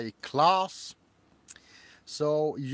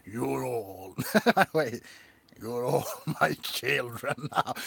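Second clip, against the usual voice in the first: Freddy Krueger voice; 2 semitones lower than usual. Pretend. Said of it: "My Freddy Krueger voice".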